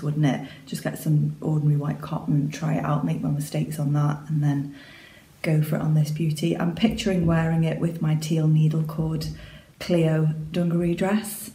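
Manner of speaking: normal talking voice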